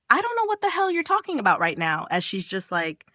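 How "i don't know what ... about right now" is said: high-pitched, flustered protest; flustered denial. A narrator's reading. Brother to the insinuating friend, flustered denial. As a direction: deny it in a raised pitch.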